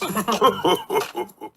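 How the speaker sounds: jolly "ho ho" chuckle; round, rolling chuckle; hearty character chuckle. A narrator's reading. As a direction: chuckle along as a pleased daddy pig. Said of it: "he chuckles".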